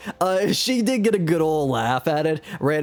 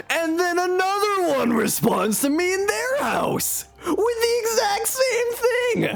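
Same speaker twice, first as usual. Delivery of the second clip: laughing disbelief. Real, not acted.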